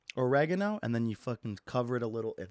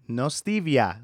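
normal talking voice

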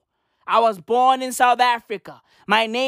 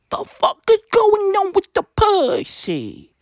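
squeaky outraged voice; 13 semitones higher, 5 dB louder than usual. Acted. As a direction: stretch it out high, then laugh. Mock outrage.

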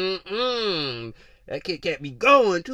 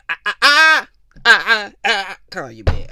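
stuttering "ah" that jumps loud and high, then drops; mock flustered stammer; 3 semitones higher, 9 dB louder than usual. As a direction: stammer helplessly, hammed up, before bailing out. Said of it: "then you ladies be like".

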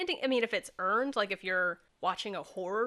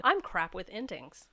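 normal talking voice

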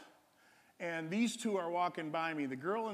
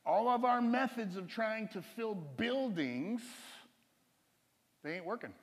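preaching emphasis; 5 semitones higher, 3 dB louder, much slower than usual. Acted. Preacher driving home the point.

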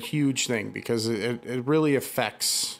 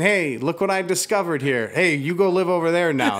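higher, louder brash invader; brash intruder; 6 semitones higher, 5 dB louder than usual. Retold or imitated. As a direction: bark it like a smug invader. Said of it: "kicking your fucking door in and saying".

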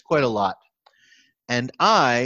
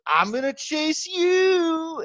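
put-on playful child voice; much slower than usual. Dad imitating his little daughter starting the chase game, high and slow. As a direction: play the daughter daring dad to run. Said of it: "she goes".

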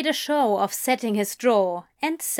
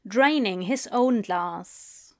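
ordinary delivery

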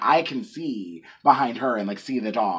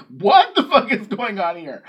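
loud, higher indignant disbelief; baffled outburst; 5 semitones higher, 5 dB louder than usual. Pretend. Coworker imagining her unfiltered reaction, baffled outburst. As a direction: blurt it out, raised and incredulous. What she'd say any other time.

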